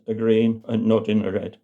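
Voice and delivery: ordinary delivery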